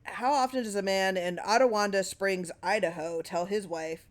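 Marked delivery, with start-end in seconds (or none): none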